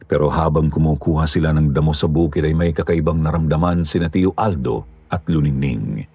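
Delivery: ordinary delivery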